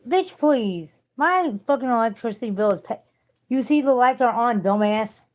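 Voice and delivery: loud, high, incredulous comeback; loud mocking indignation; indignant. Real, not acted. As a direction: call out the troll, outraged. Indignant reply to viewer comment.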